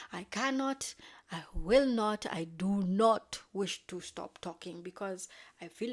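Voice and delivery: loud, acted insistence; hammed-up romantic declaration; impassioned refusal. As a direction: hit each refusal hard.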